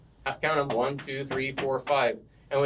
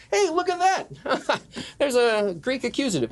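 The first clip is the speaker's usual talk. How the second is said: excited pointing-out; 8 semitones higher than usual. Pretend. Teacher showing first-form pupils a word, mock excited. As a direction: play delighted discovery, pitched well up.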